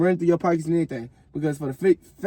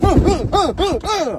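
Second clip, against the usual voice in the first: high, loud grunts with each hit; punching grunts; 12 semitones higher, 6 dB louder, slower than usual. Retold or imitated. Mimed three punches.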